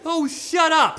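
high, loud snap; exasperated yell. Acted. Mommy silencing her rude girls, high loud outburst. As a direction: cut them off at high pitch.

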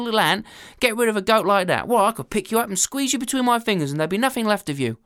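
loud, mocking disbelief; a big man sneering at a tiny ant; hammed-up scorn; scornful soldier.

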